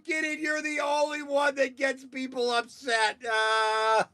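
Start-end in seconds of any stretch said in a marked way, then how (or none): none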